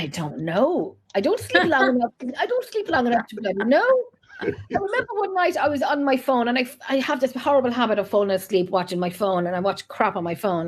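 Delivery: normal talking voice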